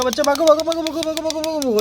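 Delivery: high, frantic urging to flee; high-pitched panicked run-run cry. Pretend. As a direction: yell at the toy child to run, voice jumping up high. Toy child urged to flee.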